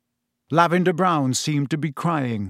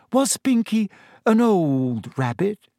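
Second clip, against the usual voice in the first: higher, slow, cautious question; Hermione voice; 7 semitones higher than usual. A narrator's reading. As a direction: tread carefully, slowly.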